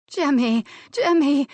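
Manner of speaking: high, loud, breathless cries of his name; gasped cries. A narrator's reading. Woman in bed gasping her lover's name, high and loud. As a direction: gasp his name out, climbing. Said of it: "she gasped".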